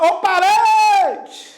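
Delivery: high, long-stretched holler; mock calling out. Student shouting words during the exam, mock calling out. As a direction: shout "parede" as if hailing someone, stretching "re". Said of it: "Eu vou gritar?".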